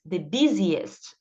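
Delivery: the busiest drawn out slowly, each syllable clear; teacher models the busiest slowly for students to copy; slow model pronunciation. Shown as a demonstration. Teacher drilling the class, saying the busiest slowly. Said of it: "Então, bus".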